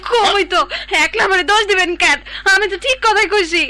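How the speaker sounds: loud, high protest; indignant wife refusing the blame; angry. Acted. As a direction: shout back in self-defence. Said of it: "এত রাগ তো তোমারে মানায় না".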